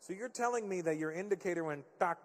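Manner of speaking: usual speaking voice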